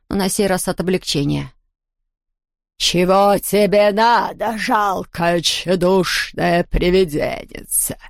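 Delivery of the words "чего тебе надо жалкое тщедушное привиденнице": slow, menacing hoarse voice; hoarse baroness. A narrator's reading. The bloody baroness sneers at Hugo, slow and hoarse. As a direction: sneer down at the little ghost. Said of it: "хриплый голос кровавой баронессы".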